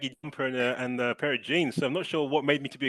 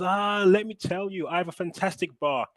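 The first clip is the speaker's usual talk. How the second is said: put-on higher-pitched touch of an old tout; lightly voiced old guy; 7 semitones higher, 3 dB louder than usual. Old guy luring a tourist to his bar, lightly imitated. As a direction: lightly act out the old man's sales pitch. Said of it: "He goes".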